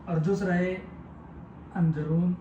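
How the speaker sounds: ordinary talk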